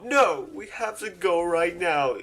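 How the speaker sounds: put-on high, drawn-out drunk demand; slow, whiny mock-drunk protest; imitated drunken slurs. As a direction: insist like a drunk friend who must leave. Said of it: "drunken slurs and shit".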